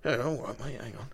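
ordinary delivery

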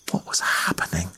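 breathless whispered thought. A narrator's reading. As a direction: whisper it to yourself in confusion. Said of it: "she thought".

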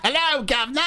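put-on hammy Cockney-style British accent; mock British accent. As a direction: mimic a cheeky English greeting. Echoing the other's British greeting.

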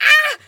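high loud startled scream; mock scream. Emily Blunt wakes to an attacker, startled scream. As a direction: shriek on waking to a knife. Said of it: "She's like".

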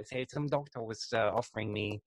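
ordinary delivery

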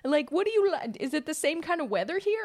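high-pitched imitation of curious partygoers; mock small-talk questions. Swedish partygoers quizzing the newcomer, mock small talk. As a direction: put on high, eager party chitchat.

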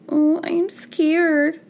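put-on high, slow little-girl whimper; baby voice. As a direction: coo it like a helpless baby. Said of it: "baby voice".

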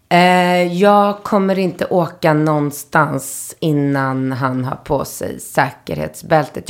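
deliberately drawn-out imitation of a driver; slow, stubborn refusal. Taxi driver to stressed passenger, slow stubborn refusal. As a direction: lay down the rule word by word, very slowly.

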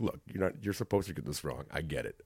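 low, soft reassurance; calming. Acted. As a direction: talk an apprentice down quietly. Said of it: "then I calm 'em down".

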